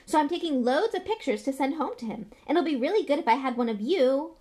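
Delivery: put-on high, loud, excited boy; overexcited young fan; Colin Creevey. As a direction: gush on without stopping, full of wonder. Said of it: "shuddering breath of excitement and said".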